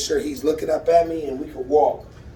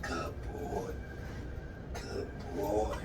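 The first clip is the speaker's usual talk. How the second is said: very soft, low murmur; hushed praise; 9 semitones lower, 17 dB quieter, slower than usual. Real, not acted. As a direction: soothe the dog with drawn-out quiet praise. Soothing praise to his dog.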